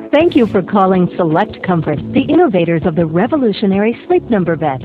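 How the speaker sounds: recorded hold message over music; phone menu voice. Acted. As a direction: read it like an upbeat on-hold ad.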